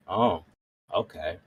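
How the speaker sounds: usual speaking voice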